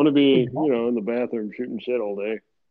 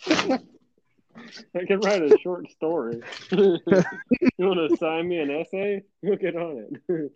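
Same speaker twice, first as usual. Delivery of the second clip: slow mock offer; 4 semitones higher, slower than usual. Mock customer offers story.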